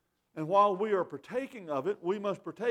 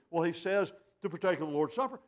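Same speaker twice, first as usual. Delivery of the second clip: ordinary talk, nothing marked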